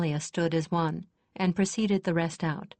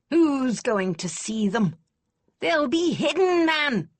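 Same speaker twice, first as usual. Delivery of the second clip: put-on higher, louder old warhorse of a lady; exclaiming; 7 semitones higher, 5 dB louder than usual. A narrator's reading. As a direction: fire up like a warhorse hearing the bugles. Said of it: "Lady Kester exclaimed".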